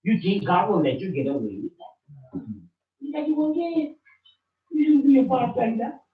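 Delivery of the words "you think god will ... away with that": usual speaking voice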